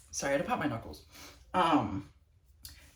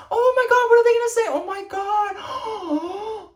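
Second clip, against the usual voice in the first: high, loud mock excitement with a gasp; mock gushing; 8 dB louder, faster than usual. Mocking onlookers thrilled by a promposal, high and loud. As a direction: gush like a starstruck fan. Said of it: "I'm not like".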